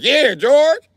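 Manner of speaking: high cheering shout of approval; a high excited cheer. Retold or imitated. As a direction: crowd cheering their general on. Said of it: "Everybody was like".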